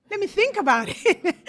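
coy, giggling put-off; amused brush-off ending in a laugh; laughing tease. Retold or imitated. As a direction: laugh through the words, then a high laugh. Teasing answer to suitor.

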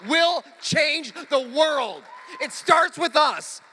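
loud, ringing preacher's build to a slow close; preaching at full force. Acted. Pastor opening the sermon to the church, preaching loud. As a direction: proclaim it to the whole congregation.

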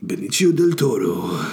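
put-on theatrical Spanish accent, rolled out grandly. Podcaster naming an actor in his mock Spanish advertisement accent. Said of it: "full Spanish or advertisement".

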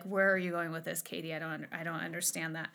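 lightly voiced puzzled listener, dropped low and soft. A listener questioning where the teacher is going, puzzled. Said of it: "You're like".

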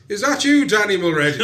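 high, loud shout of recognition; shouted call. Acted. Captain calling up to Danny on the crane, shouting. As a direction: holler up to someone far above. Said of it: "And he shouts".

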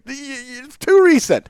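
very high, stammering mock protest; imitated co-host objection. Teasing co-host by predicting his objection, high and sputtering. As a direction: sputter it in a squeaky mocking imitation. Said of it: "You're gonna say".

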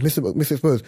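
normal talking voice

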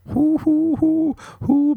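very high hooting; mock hooting. Comic cheering seventeen months of quarantine, very high hoots. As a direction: whoop like a mocking owl.

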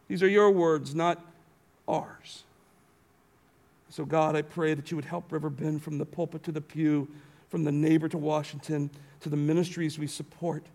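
solemn pastoral prayer. Acted. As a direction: pray it reverently. Closing prayer.

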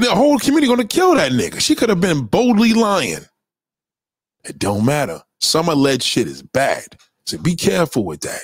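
ordinary talk, nothing marked

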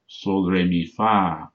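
sol, re, mi, then a stronger FA; stress on final syllable. Shown as a demonstration. Showing the adverb form, stress on FA. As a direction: land firmly on fa, the last syllable. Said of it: "accent the final syllable".

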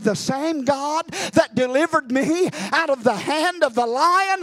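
builds from firm to high, loud shout; defiant battle cry of faith, mounting; rising declaration. Retold or imitated. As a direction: climb to a triumphant roar.

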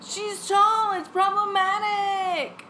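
high, loud, drawn-out pitch; mock-outraged wail. Pitching the film to the studio, mock-outraged wail. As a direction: wail the premise in exaggerated dismay.

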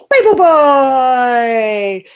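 very slow, stretched title call; game title announcer. Acted. Comic revealing her Pope delivery game, drawn-out announcer call. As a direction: announce it with the last syllable held long.

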